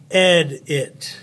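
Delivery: "Ed it" as two separate beats, stronger "Ed"; split into syllables. Shown as a demonstration. Teacher sounding out the spelling, "Ed it" in two separated syllables. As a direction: break the word into "Ed", gap, "it". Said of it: "that's why you know how to say the words".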